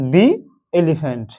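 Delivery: "দি এলিফ্যান্ট" said as thee elephant; long ee in "দি", then "এলিফ্যান্ট"; THE before a vowel. Shown as a demonstration. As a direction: model "দি" rhyming with "see". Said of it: "এর উচ্চারণ কি হবে".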